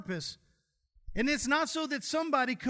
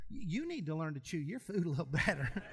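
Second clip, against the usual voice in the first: softer, lower, laughing through it; laughing advice; 6 semitones lower, 5 dB quieter than usual. Retold or imitated. Preacher retells teasing his sick wife, laughing. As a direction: give advice while cracking up. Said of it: "I said".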